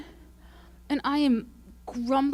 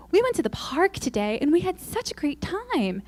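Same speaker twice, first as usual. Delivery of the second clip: mock cheery blogger; 7 semitones higher than usual. Mimicking a cheery blogger post.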